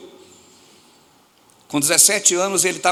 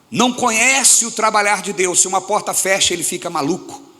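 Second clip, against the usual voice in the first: preaching at full force; 4 semitones higher than usual. Real, not acted. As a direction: stretch each phrase out over the church. Preaching peak, drawn out.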